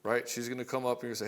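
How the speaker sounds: normal talking voice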